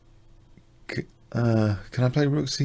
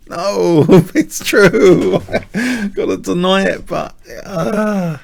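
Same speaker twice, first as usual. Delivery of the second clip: high, loud giggly protest; laughing; 9 semitones higher, 8 dB louder, much slower than usual. Real, not acted. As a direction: laugh through a sheepish denial. Laughing denial of bad position.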